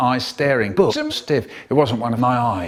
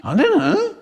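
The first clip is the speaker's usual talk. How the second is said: very high, small, feeble voice; weedy little voice; 12 semitones higher than usual. Acted. Michael admitting it to May, weedy little voice. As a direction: squeak it out weedily, rising at the end. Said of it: "in a weedy little voice I sang".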